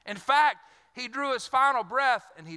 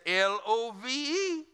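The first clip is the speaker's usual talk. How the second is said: slow careful spelling; slower than usual. Retold or imitated. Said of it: "He said".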